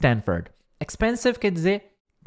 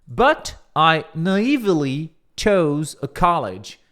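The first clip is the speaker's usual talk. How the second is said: slow, each word laid out; slow careful reading; 3 dB louder, much slower than usual. Shown as a demonstration. Teacher reading the speech line slowly. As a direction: read it out slowly for learners. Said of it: "conferir a pronúncia aqui".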